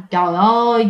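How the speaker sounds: usual speaking voice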